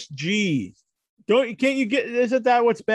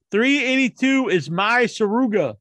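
ordinary talk, nothing marked

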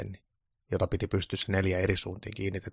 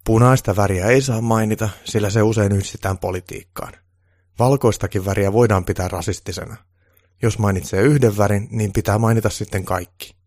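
a hint of a loud, lecturing official; lecturing advisor; 2 semitones higher, 11 dB louder than usual. Official lectures Santa about forbidden words, a little loud. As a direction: lay down the rules to Santa firmly.

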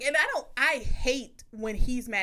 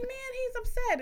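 much higher, fretting; mock worried; 13 semitones higher than usual. Noticing her partner is upset, high mock fret. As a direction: fuss over it in a high voice. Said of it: "I just be like".

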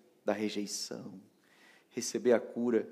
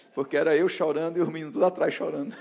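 loud, higher, breaking into laughter; laughing; 6 semitones higher, 5 dB louder, faster than usual. Real, not acted. Preacher recalling everyone crying behind him, laughing. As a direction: tell it through real laughter. Laughs at own funeral-like procession.

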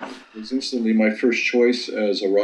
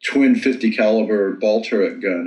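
ordinary talk

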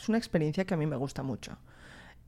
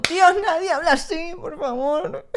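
exasperated; 10 semitones higher, 5 dB louder than usual. Real, not acted. Exasperated reaction to dubbing.

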